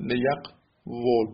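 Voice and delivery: slow لیقول as le-yaq-vol; original form liyaqvul. Shown as a demonstration. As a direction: split لیقول slowly, v opening the last syllable.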